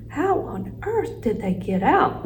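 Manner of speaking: wondering. A narrator's reading. Teacher finds classroom pets loose, wondering. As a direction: stretch it out in puzzlement. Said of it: "Missus Brisbane wondered".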